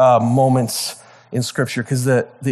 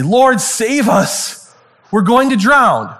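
panicked plea; 7 semitones higher, 4 dB louder, much slower than usual. Retold or imitated. Disciples cry out in storm.

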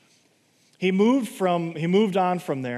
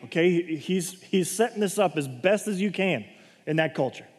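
ordinary talk

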